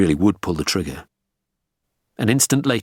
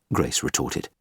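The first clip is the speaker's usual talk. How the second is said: ordinary delivery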